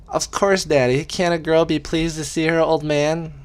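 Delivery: high, syrupy put-on girly voice; a daughter laying on the sweetness for her father; mock sweet daughter. Daughter teasing her dad, sugary high voice. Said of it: "she didn't say it like that, but that's how she said it in my head".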